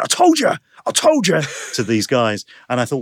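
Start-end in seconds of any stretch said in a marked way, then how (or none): 0.0-1.4 very high, loud crowing; triumphant mock shout. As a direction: imitate someone loudly proving his mates wrong. Retold stranger crowing to his mates.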